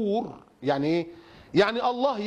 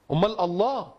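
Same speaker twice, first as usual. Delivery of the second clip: ordinary delivery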